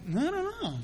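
high, soft shrugging voice; a gossip who can't name a source; mock clueless shrug. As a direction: play dumb with a shrug.

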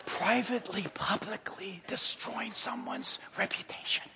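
hushed mock-secretive tone; whispering. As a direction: confide it like a gossip in a huddle. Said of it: "if it's being whispered".

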